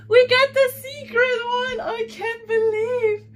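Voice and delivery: high, loud squeals of delight; shrieking with joy. Real, not acted. Unboxing the secret figure she waited for, squealing with joy. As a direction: scream with real excitement over the rare find.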